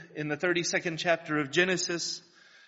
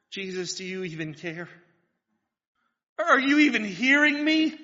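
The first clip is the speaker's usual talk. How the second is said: sad unbelief; 3 semitones higher, 4 dB louder than usual. Retold or imitated. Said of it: "sadly, unbelievingly".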